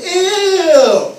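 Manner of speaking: high, loud grossed-out cry; disgusted ew. Retold or imitated. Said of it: "And they said".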